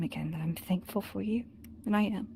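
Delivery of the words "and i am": ordinary delivery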